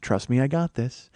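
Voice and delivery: low, soft, unhurried murmur; cool reassurance. Retold or imitated. [name] answering the album outrage, low calm assurance. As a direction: play her calm against the uproar. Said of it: "She's like".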